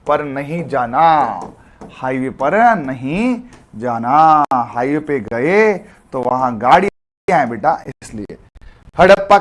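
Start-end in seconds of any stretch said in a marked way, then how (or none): 0.1-1.4 slow warning. Shown as a demonstration. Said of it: "ध्यान रखना". 1.9-4.4 highway पर नहीं जाना spelled out slowly, each word weighed; slow drilled warning. Shown as a demonstration. Teacher warns the class off the highway, slow and low. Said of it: "ध्यान रखना". 4.5-5.7 slow warning. Shown as a demonstration. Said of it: "ध्यान रखना".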